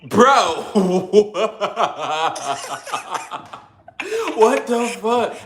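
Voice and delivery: high, loud burst of laughter around the words; real laughing outburst. Real, not acted. Host cracking up at the guest's embarrassing story, laughing loud and high. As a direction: break up laughing in disbelief.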